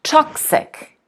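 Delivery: toxic, high TOX falling to ic; stress on TOXIC. Shown as a demonstration. Teacher stressing TOX in toxic, falling. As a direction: punch TOX in toxic, then fall. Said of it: "It is on the first syllable".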